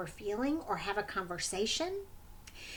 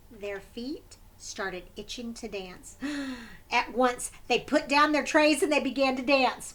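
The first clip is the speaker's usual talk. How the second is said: ordinary delivery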